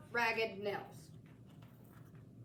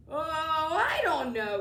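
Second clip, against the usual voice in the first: slow, louder mock-scary voice; mock terrifying; 4 semitones higher, 6 dB louder, slower than usual. A narrator's reading. Mac tries to scare the boy, mock menace. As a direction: play the spooky monster. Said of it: "trying to sound terrifying".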